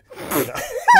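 very high, loud laugh at his own slip; burst of laughter. Real, not acted. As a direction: crack up at the slip. Caught saying YouPorn.

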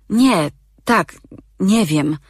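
high, confused stammer; flustered. Acted. Sigrun answers Bodwar, flustered and confused. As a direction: tumble over the answers.

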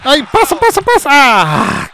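very high calls for the pass; urgent squeal. Real, not acted. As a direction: beg for the pass. Urging a pass. (apelo agudo)

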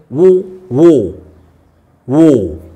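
ordinary talk

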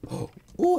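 high gasping ooh of discovery; sudden delighted gasp; surprised gasp. Real, not acted. Gamer spotting ore, gasping in surprise. Spots something in cave.

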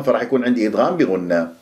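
usual speaking voice

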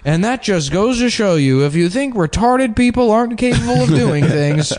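a hint of an inspirational announcer. Continues mock announcer speech.

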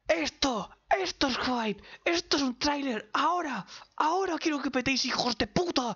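agudo y enfático, palabra a palabra; euforia desatada. Real, not acted. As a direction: suelta cada frase con golpe eufórico. Eufórico tras ver el tráiler. (entusiasmo exaltado y agudo)

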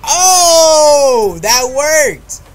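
loud, high astonished cry; surprised delight. Real, not acted. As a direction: shout in disbelief that it worked. Surprised success in game.

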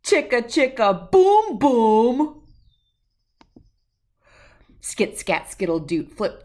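usual speaking voice

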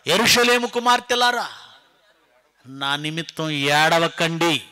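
loud scripture quote, slow at the end; proclaimed command. Acted. Jesus to the daughters of Jerusalem, loud solemn call. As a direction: call out to the crowd, drawing out the last word. Voices Jesus addressing the women.

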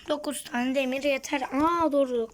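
ordinary talk

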